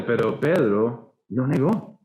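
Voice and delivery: ordinary delivery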